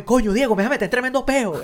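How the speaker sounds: high, exasperated Caracas slang with "Coño" thrown out first; Venezuelan exclamation. Retold or imitated. Doctor protesting to Diego, high exasperated Venezuelan slang. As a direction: say "tremendo peo" like an exasperated Venezuelan. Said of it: "se lo dice de una manera muy".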